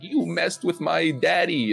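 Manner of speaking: high-pitched, drawn-out whiny fan impression; exaggerated high fanboy whine; mocking fanboy voice. Mocking imitation of angry fans.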